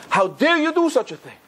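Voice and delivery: high, indignant father's rebuke; outraged father. Retold or imitated. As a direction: scold the kid who spilled juice. Father glares at grape-juice spiller.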